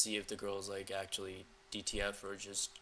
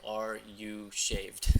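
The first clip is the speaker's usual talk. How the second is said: slow, firm, spaced-out words; teasing word-by-word mimicry; 6 dB louder, much slower than usual. Coach showing a playful sexual tease, slowly word by word. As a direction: tease by landing each word separately. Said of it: "So you're teasing her".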